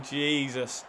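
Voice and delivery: usual speaking voice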